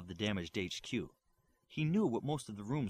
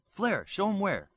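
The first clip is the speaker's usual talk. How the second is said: calling out; 10 semitones higher, 7 dB louder, slower than usual. Acted. Bioforce calls out to Flare.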